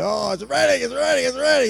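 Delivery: high-pitched, eager mock pleading; impatient clamouring. Hungry family waiting round the stove, impatient clamouring. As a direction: insist in a rising, hurried kitchen clamour.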